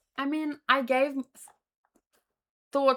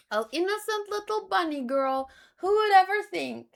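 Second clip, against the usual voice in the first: higher, sing-song mock innocence; teasing, higher and louder on the last question; mock cutesy voice; 8 semitones higher, 4 dB louder than usual. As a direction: play up the cute bunny girl. Mocking the cute suspect.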